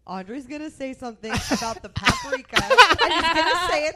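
can barely get it out for laughing; laughing retelling. Retold or imitated. Friend recalling what was said to Luis, laughing.

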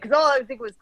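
normal talking voice

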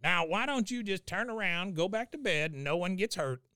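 high-pitched mock threat; put-on voice warning someone off; Cotton. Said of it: "He says".

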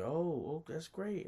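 high, delighted reaction in her voice; high-voiced imitation of a pleased hostess; imitated pleased woman. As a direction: mimic her being flattered, pitched well up.

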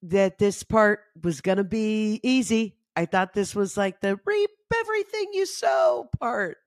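mock indignant outburst; frustrated rant. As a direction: vent it like you've been cheated. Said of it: "I'm like".

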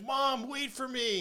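high, slow mock wail; high drawn-out kid's plea; pleading daughter. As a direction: call after mom, high and drawn out. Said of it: "she's like".

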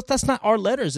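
ordinary delivery

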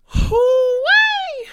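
long, high, loud whoop; whooping "hoo-wee". Real, not acted. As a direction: let out a drawn-out high holler. Exclaims at how worked up she was.

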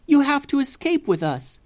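Bussy pleading from the boat; pleading cry. Acted. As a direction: beg them to come. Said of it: "yelled Bussy".

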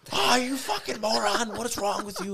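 high, loud mock yell of an enraged old man; furious grandfather. Grandfather berates his grandson for quitting, high furious yell. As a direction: scream at him like a livid grandpa. Grandfather rips him apart.

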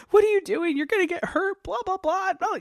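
high, teasing imitation of her worried cry; light imitation of the crying heroine; mock tearful heroine. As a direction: playfully ham her scolding through tears. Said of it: "She's just like".

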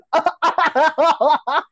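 mocking high fake cackle; exaggerated put-on laugh, high and staccato; Kamala Harris. Mimicking a politician after her gaffe, phony cackle. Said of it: "laugh that's put on, like when you hear Kamala Harris".

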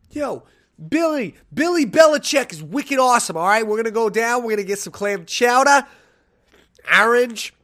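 loud, high, hammed-up Boston sports fan; badly faked Boston accent, belted out; horrible Boston accent. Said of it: "That's a horrible Boston accent".

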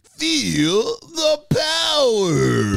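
booming intro shout. Pretend. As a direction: belt it like a wrestling entrance. Show intro catchphrase.

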